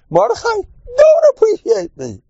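very high-pitched mimicked grievance; mock complaint.

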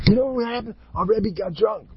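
high, breathless-with-news child imitation; gleeful high-pitched tattling; excited kids. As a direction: mimic a kid bursting to tell.

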